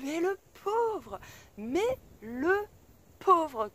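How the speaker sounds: high, drawn-out pitying lament; exclaimed pity. Retold or imitated. Driver pitying the man with such daughters, drawn-out lament. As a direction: stretch each word in mock commiseration.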